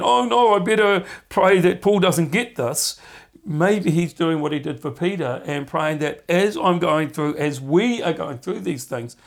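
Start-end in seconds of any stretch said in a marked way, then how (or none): 0.0-3.0 high, loud mock panic; hammed-up worried cry; mock alarm. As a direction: pretend to fret in a hurry.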